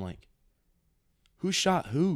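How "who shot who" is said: loud, high-pitched disbelief; retold burst of alarmed confusion; shocked question. Stepson answering screaming stepmom, shocked question.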